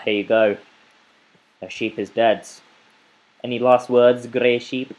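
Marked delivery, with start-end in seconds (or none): 3.4-4.9 louder, playful taunt; mock menace. Player about to kill sheep, playful loud taunt.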